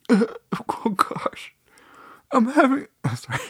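high, breaking mock-overcome voice; pretend emotional breakthrough. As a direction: fake being overcome, apologizing through it. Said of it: "what if I was really having a breakthrough".

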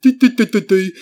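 mock dopey normie; dopey tourist. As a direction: play a clueless phone-staring tourist. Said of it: "normie's walking around like".

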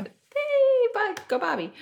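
high, excited cheer. Real, not acted. Fan rooting for a favorite TV star, high-pitched cheer. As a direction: cheer on a favorite in a squealed, drawn-out call.

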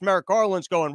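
usual speaking voice

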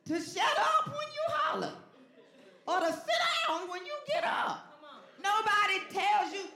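very high, loud hollering; full-force preaching. Real, not acted. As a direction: holler the point at the congregation.

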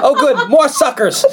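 high, laughing imitation of delight; excited. Co-host teasing a friend about the movie, excited. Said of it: "She was very excited".

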